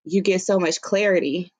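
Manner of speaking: ordinary talk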